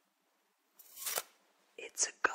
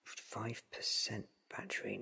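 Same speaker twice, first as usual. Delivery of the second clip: normal talking voice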